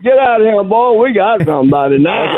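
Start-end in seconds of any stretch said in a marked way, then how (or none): none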